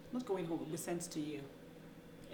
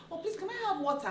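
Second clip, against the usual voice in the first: high-pitched, polite request; asking for water; 12 semitones higher than usual. Retold or imitated. Accented customer asking Americans for water, high polite request. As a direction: ask politely in a raised voice. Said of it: "sometimes you go on there, you're like".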